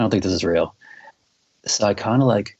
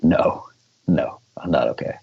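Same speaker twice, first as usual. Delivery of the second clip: lower, stunned denial from a rattled friend; shaken bandmate; 4 semitones lower than usual. Retold or imitated. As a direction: answer low and dazed, like you just survived a crash. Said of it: "And he's like".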